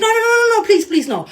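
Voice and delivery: high, loud mock-panicked pleading; imitating freaked-out panic. Boyfriend begging her not to try the trick, mock panic. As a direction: rattle off a frantic string of refusals, imitated. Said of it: "He freaked completely out".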